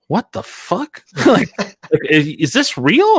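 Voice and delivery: high incredulous squeal; playing stunned coworkers, pitch jumping up; mock disbelief. Coworkers hearing about the party, mock shocked.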